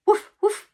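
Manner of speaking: high, loud playful woofs; dog barking. Shown as a demonstration. Teacher showing kids what a dog says, loud woofs. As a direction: bark like a little dog. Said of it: "What does a dog say".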